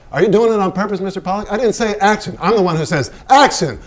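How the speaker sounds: irritated filmmaker telling off his star; angry director. Acted. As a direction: scold the painter for jumping the cue.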